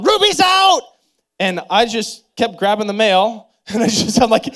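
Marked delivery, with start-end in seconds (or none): 0.0-0.8 put-on high loud child's yell; kid's yell. Child yelling to dad that the dog escaped, loud high yell. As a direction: shout the alarm like a startled kid. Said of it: "heard one of them yelling".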